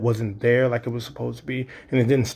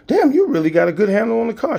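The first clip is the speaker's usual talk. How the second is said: much higher, fast, thrilled; excited praise; 11 semitones higher, 5 dB louder, faster than usual. Retold or imitated. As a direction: gush about her driving. Relieved dad praising daughter.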